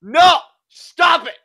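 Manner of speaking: high, loud desperate no; pleading shout. Real, not acted. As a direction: yell at the screen to stop. Pleading at the screen.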